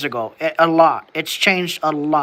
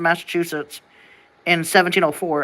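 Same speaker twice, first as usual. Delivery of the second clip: ordinary talk, nothing marked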